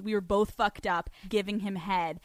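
ordinary talk, nothing marked

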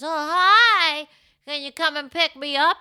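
high, loud sugary ask; butter her up; sweet request. Retold or imitated. Imitating the husband asking for a pickup in a sweet, high voice. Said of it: "of course, says".

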